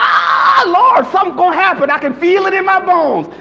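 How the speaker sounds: hammed-up terrified cry; panicky scream. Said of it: "Some start screaming".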